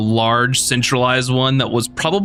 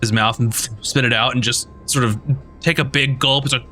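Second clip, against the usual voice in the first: ordinary talk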